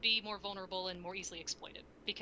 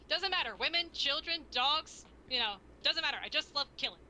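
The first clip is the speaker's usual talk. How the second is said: cheery killer voice, higher and louder; upbeat Crumbopulous voice; 7 semitones higher, 5 dB louder than usual. Retold or imitated. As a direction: chirp about killing like a happy psycho. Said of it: "he's just got the most upbeat voice".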